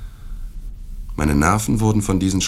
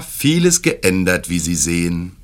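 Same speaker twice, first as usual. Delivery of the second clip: roared in unison; 2 semitones higher, 4 dB louder than usual. A narrator's reading. Whole table roars the host's words back, mock roar. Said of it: "brüllte die ganze Gesellschaft".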